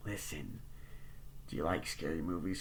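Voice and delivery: a quiet sinister question; creepy caller. Retold or imitated. Killer asking Sydney about scary movies, low and quiet.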